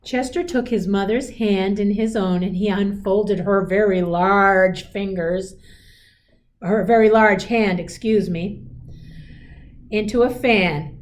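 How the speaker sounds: gentle storybook read-aloud; a teacher reading a picture book aloud; reading to children. A narrator's reading. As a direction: read it like a bedtime story to a class.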